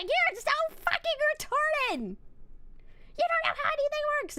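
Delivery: imitating angry mom. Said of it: "She got so mad at me".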